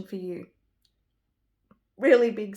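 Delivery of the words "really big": sudden much louder cry of emphasis; excited burst. Real, not acted. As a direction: blurt it out, overcome by the reading. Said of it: "I can't even speak".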